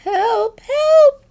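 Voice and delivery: very high, loud panicked cry; frightened little creature screaming for help; Piglet crying out. A narrator's reading. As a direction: shriek it in terror. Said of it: "cried Piglet".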